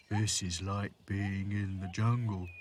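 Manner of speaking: slow doll character voice; Sad Sack musing. A narrator's reading. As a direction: draw the thought out slowly. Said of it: "thought Sad Sack".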